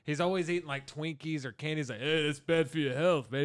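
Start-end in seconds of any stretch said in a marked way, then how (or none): 2.0-3.4 a mild put-on voice for the buddy cop; partner impression. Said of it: "I'm doing the same voice from that other guy".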